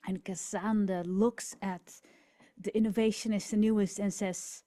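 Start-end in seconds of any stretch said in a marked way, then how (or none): none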